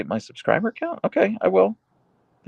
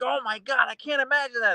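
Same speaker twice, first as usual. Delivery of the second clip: much higher, gasping put-on pity; mock shocked sympathy; 12 semitones higher than usual. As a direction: gush like a melodramatic friend. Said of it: "I'm like".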